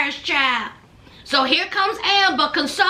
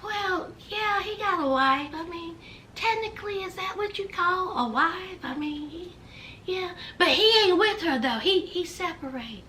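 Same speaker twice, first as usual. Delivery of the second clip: dazed stoned mumble; 8 dB quieter than usual. Retold or imitated. Puppy answering her friends in a dazed, soft mumble. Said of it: "So Puppy was like".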